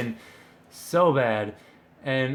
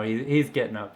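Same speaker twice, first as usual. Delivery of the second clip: ordinary delivery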